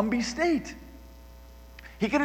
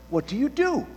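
ordinary delivery

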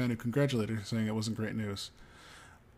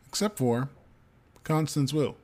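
ordinary delivery

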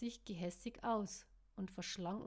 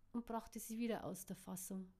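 usual speaking voice

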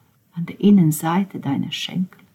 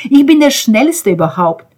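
proud outcry; 7 semitones higher, 5 dB louder than usual. Acted.

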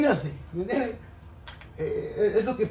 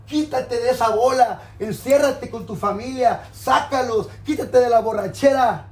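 loud pleading sermon; fiery preaching; 2 semitones higher, 4 dB louder than usual. Real, not acted. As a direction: call them out from the pulpit. Preaching at full force.

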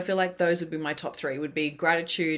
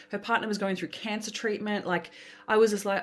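normal talking voice

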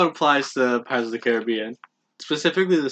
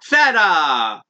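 loud, higher attempt at an accent; yelling; 7 semitones higher, 6 dB louder, much slower than usual. Cohost asked for a New York accent, yelling instead. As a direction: yell it out, stretching the word. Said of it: "You're just yelling".

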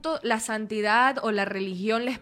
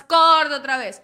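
loud, slow, fed-up cut; exasperated director; 4 semitones higher, 10 dB louder, slower than usual. Retold or imitated. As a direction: bark cut again, slowly. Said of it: "La levanté un poco más".

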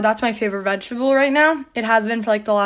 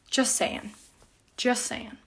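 quiet aside; 10 dB quieter, slower than usual. Real, not acted. Teasing future boyfriends.

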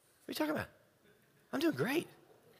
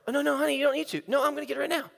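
higher, bright eager voices; eager exchange; 8 semitones higher, much faster than usual. Retold or imitated. Husband insisting on helping his wife, higher and eager. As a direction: push the offer cheerfully.

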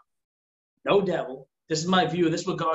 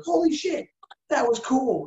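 high, excited amazement; kid's high amazed shout; 9 semitones higher than usual. Retold or imitated. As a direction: gasp it out like a thrilled kid. Child amazed at inner voice.

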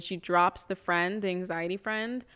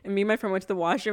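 ordinary talk, nothing marked

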